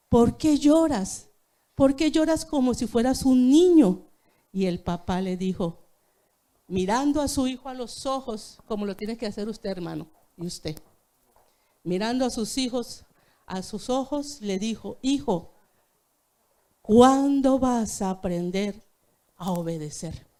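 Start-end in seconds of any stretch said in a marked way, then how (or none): 0.1-4.1 put-on pleading child cry. Son asks crying father why. 15.0-20.3 pleading father. Retold or imitated. Weeping father pleads with son.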